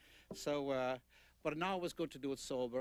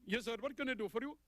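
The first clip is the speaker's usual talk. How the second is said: much higher put-on voice of a clerk answering the phone; Indian phone clerk; 9 semitones higher, faster than usual. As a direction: play the trading company clerk picking up, pitched high.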